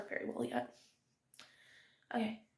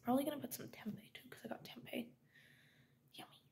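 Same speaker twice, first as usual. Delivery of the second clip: whispering; 8 dB quieter than usual. Real, not acted. Whispering while making breakfast.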